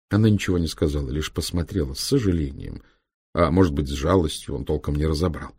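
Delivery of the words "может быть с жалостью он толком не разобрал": plain reading voice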